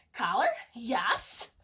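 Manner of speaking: ordinary delivery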